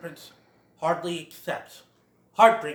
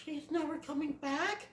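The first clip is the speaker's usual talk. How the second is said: much higher, much softer small question; small sad Bambi; 11 semitones higher, 10 dB quieter than usual. Retold or imitated. Bambi realizing his mother is gone, sad. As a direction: ask it with dawning grief. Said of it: "he goes".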